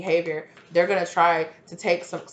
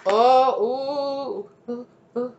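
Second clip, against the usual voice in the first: creeped out; 6 semitones higher, 3 dB louder, much slower than usual. Real, not acted. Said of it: "I just got such a creepy".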